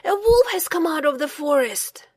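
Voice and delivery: urgent shouted warning; shouting. A narrator's reading. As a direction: cry out the warning. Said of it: "he shouted".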